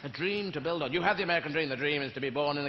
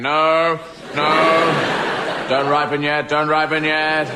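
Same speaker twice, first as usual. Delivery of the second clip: voiced stubborn pears; 10 dB louder, slower than usual. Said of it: "they sit there going".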